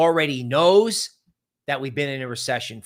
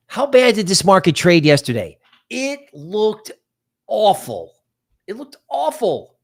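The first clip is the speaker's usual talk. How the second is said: dramatic recap; 4 semitones higher, 7 dB louder than usual. Real, not acted.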